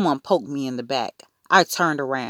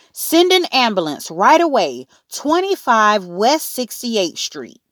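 high, loud frantic order; urgent demand; 7 semitones higher, 5 dB louder than usual. A narrator's reading. Mother ordering an ambulance from operator, frantic.

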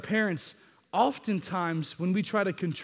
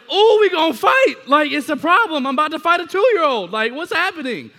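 loud, much higher mock outrage at a toddler; 10 semitones higher, 11 dB louder than usual. Dad slapped by his two-year-old, shouting in mock outrage.

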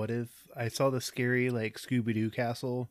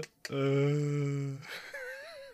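cackling at own pun; corny dad laugh; 3 semitones higher than usual. Real, not acted. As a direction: laugh at your own bad joke. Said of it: "I'm done laughing at my own joke".

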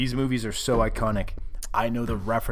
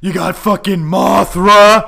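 slow loud promo bellow; wrestling promo yell; hype shout; 7 semitones higher, 12 dB louder, slower than usual. Pretend. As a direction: yell it slowly at the crowd.

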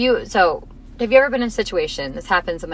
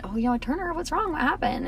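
soft and higher, a tender mother asking her upset son; gentle mom voice; 7 semitones higher, 8 dB quieter than usual. Retold or imitated. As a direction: hush it down and lift it, coaxing a crying child. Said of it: "And I said".

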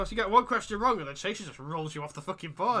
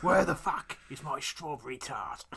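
slow put-on movie voice; measured, hammy film impression; film quote; slower than usual. Film hero demanding his strawberry tart, slow mock menace. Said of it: "the bit in Crank Two".

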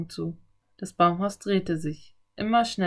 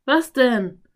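loud, higher questioning call; raised voice answering a call; calling back; 5 semitones higher, 7 dB louder than usual. A narrator's reading. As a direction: shout a quick question back. Calls back to Anne outside.

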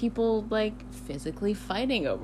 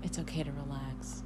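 calm and soft; 6 semitones lower, 12 dB quieter than usual. Real, not acted. Gives calming advice.

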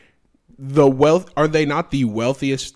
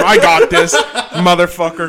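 loud, higher, with a laugh; louder redo; 3 semitones higher, 8 dB louder than usual. Shown as a demonstration. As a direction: punch it up on the redo.

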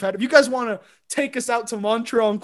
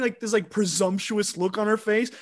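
ordinary talk

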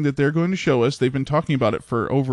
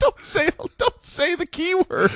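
alarmed high pleading. Real, not acted. Panicked plea, laughing.

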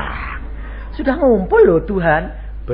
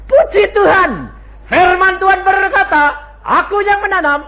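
louder, higher cry of praise; 12 semitones higher, 7 dB louder than usual. Shown as a demonstration. Preacher modeling a joyful answer to the congregation, loud and high. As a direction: proclaim it boldly to the congregation. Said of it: "saudara bisa bilang".

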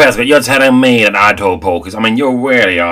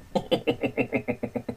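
long, soft chuckle after boasting; quiet, trailing smug laughter; self-satisfied laugh; 3 semitones higher, 13 dB quieter than usual. Acted. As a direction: chuckle grandly at your own importance. Boasting about crowning the King.

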